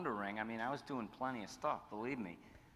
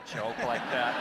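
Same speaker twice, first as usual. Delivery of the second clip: laughing disbelief; 10 dB louder, slower than usual. Retold or imitated. Retold scoff at prank call.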